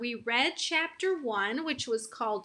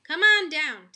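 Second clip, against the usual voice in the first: mom calling upstairs, louder; 5 semitones higher, 8 dB louder than usual. A narrator's reading. Mom calls her son down to breakfast, calling out. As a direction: call him down to breakfast. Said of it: "my mom called".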